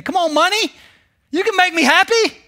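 high, whiny mock plea; mock pleading with money. Preacher pretends to beg money for happiness, high mock whine. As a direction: beg your cash like it's a god. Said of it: "That's like me saying".

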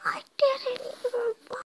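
high, whining protest; exasperated wail. Real, not acted. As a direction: wail at the unfairness of the game. Protests a failed game attempt.

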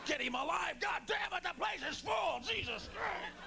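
frantic high yells of a panicked medic; mock frantic paramedic shouting; panicked paramedics yelling. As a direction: shout it like chaos in the ER. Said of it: "the paramedics going".

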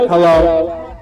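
ordinary talk, nothing marked

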